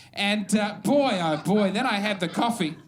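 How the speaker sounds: normal talking voice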